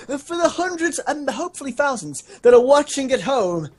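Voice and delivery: usual speaking voice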